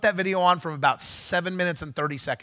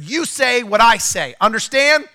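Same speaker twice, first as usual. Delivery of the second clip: high, loud and slow, laying down the rules; commanding shout; 8 semitones higher, 8 dB louder, slower than usual. Retold or imitated. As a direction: order the bullhorn man, slow and firm. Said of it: "and I said".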